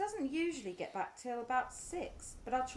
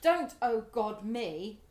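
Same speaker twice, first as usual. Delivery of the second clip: sharp rebuke; 5 semitones lower, 6 dB louder, slower than usual. Acted. Wife rebukes husband's remark.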